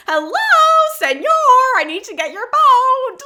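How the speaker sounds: calling out. Retold or imitated. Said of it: "he tries to get Charon's attention".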